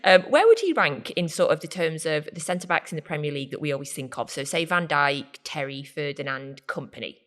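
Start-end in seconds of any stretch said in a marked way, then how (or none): none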